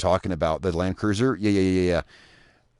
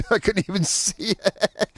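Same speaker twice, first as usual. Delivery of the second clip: laughing; 10 semitones higher, slower than usual. Real, not acted. Laughing at sunk truck.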